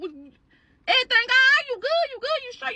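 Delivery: put-on concerned boyfriend. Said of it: "Your man like".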